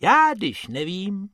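a small bunny wondering where they are; worried rabbit. Acted. Bob tells Bobek the hat is gone, high.

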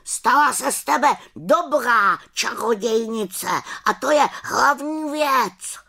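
high-pitched raven voice; calling after her. A narrator's reading. Raven encourages the departing little witch, calling out high. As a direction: call out high, drawing out the end. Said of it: "volal za ní na rozloučenou".

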